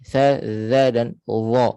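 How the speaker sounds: Sa, za dan dha, each said slowly with the tongue tip out; Sa, za, dha said slowly, tongue between teeth; slow tongue-tip letters. Shown as a demonstration. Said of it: "tiga huruf yang bila kita sebut, kita kena keluarkan sedikit hujung lidah".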